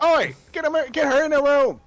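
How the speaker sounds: high, loud barking guard; guard yelling. Acted. Guard shouting to catch the sneaking intruder, high and loud. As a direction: bark the order high and loud at the others. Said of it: "He's like".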